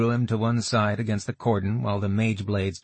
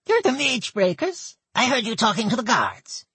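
goblin voice; 3 dB louder than usual. Acted. Goblin woman Gertel speaks.

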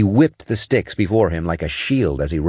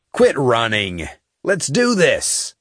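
challenging shout; 6 semitones higher, slower than usual. A narrator's reading. Cross challenges the alien emperor.

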